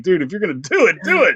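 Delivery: exasperated. Retold or imitated. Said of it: "but I'm like".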